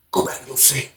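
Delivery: breathless whisper of a command; guard whispering to send him back to his cell; whispered order. Retold or imitated. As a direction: hiss it without voice. CO repeats the order.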